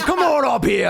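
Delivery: high, eager invitation; imitated hearty welcome. Orcs calling their elf friend up, welcoming. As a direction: wave a pal over with your voice.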